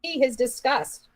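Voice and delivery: ordinary talk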